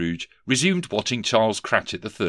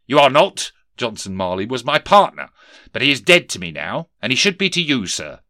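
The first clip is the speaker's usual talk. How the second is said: higher, louder boss voice of a sneering Scrooge; stern miser boss; 4 semitones higher, 7 dB louder than usual. Acted. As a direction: bark it down at the clerk. Scrooge rebuffs collector.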